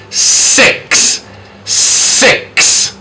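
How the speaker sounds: "Six" with long hissing s at both ends; hissed s. Shown as a demonstration. Teacher modelling the S sound, "Six" slow and sibilant. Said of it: "the basic S sound".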